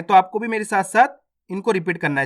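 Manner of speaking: ordinary talk, nothing marked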